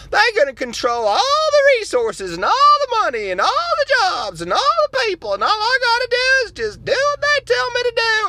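high, loud gushing activist voice; excited liberal caricature. Acted. Acted liberal character.

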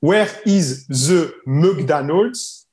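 very slow Where is the McDonald's with a French accent; Where is the McDonald's, said slowly in English with a French accent; slow English demo. Shown as a demonstration. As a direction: spell out the question slowly for a learner. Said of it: "Et si elle avait dit Where is".

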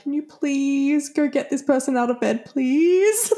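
high, pleading coworker voice, rising on the last please; mock pleading. Worker imitating a coworker's plea to rouse someone, mock pleading. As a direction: beg like a fed-up coworker.

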